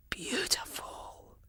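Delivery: slow, soft unvoiced whisper; awed whisper. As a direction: linger on it in wonder. Whispering in awe again.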